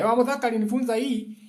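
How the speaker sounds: high, quick mock quote; imitated careless student. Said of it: "Don't just say anything nimekuambia".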